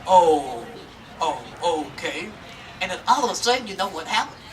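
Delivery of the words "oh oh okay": deep slow laughing oh okay; a dim bear swallowing the story with a chuckle; slow low chuckling okay. Acted. Bear believing the rabbit's lie, slow low chuckling okay.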